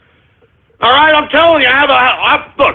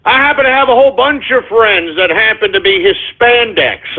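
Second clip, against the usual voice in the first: ordinary talk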